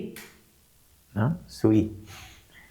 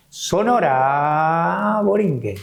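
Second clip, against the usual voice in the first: stretched name; 3 semitones higher, much slower than usual. Retold or imitated. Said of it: "estiralo el nombre". ("Sonora Borinquen" estirado, muy lento, alargando cada sílaba)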